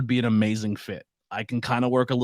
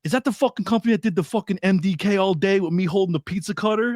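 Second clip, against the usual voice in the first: higher, lightly voiced rough wrestler; Nick Gage impression; 7 semitones higher than usual. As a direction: toss off the rough wrestler's question. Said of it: "horrible impression".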